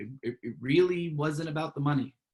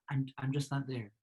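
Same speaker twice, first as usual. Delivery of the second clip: soft, even, unruffled reply; demonstrating calm under provocation; talking calmly; 7 dB quieter than usual. Shown as a demonstration. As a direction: stay quiet and refuse to rise to it. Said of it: "you're talking calmly".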